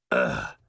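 very low groan of complaint; low, grumbling "ugh"; disgusted ugh. A narrator's reading. Father dismayed at daughter's boyfriend, groaning in complaint. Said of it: "Henry complained".

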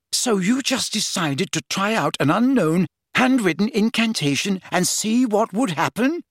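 high, sharp disbelief; incredulous scolding. Acted.

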